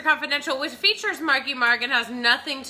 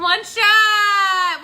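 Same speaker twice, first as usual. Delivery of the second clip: shouting; 6 semitones higher, 5 dB louder than usual. Real, not acted. Toasting call out.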